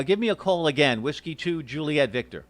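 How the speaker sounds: ordinary delivery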